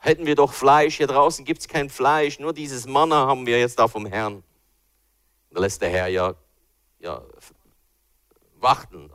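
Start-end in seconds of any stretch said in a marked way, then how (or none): 0.0-4.4 higher, louder whiny complaint; grumbling Israelites. Retold or imitated. Israelites moaning about manna in the desert, loud whining. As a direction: moan longingly about the food back home. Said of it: "Und immer und immer wieder".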